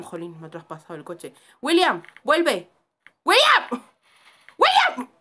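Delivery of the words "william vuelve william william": loud calls climbing to a high yell; calling out a name. Real, not acted. Player calls William back, rising yell. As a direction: holler for someone to come back, higher each time.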